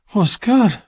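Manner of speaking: high, panting female voice; breathless woman. Acted. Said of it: "she pants".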